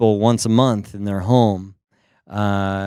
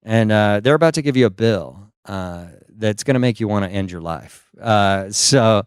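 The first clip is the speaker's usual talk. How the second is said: ordinary delivery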